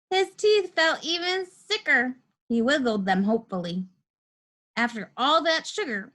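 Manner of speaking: ordinary delivery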